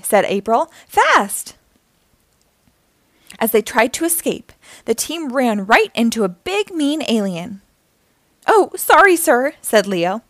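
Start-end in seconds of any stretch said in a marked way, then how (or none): 0.9-1.6 high urgent cry; urgent call. A narrator's reading. April hurries the team away, urgent call. April urges escape from vendors. 8.5-9.5 high startled start, easing down; startled apology. A narrator's reading. Leo bumps into an alien, startled apology.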